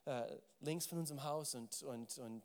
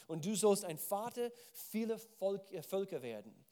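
scripture reading; 5 semitones higher, 6 dB louder than usual. Acted. Reads God's promise aloud.

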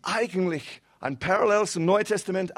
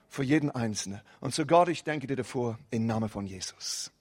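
short prayer; 4 semitones lower than usual. Acted. Pastor praying for his church before preaching, hushed and earnest.

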